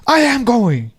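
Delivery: imitated protest, pitched high. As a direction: mimic the man's insistent, high-pitched comeback. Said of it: "starts lifting up his voice".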